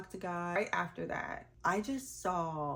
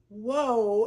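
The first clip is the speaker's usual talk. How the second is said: amazed whoa; 4 semitones higher, 13 dB louder than usual. Retold or imitated. Stunned by cousin's offer.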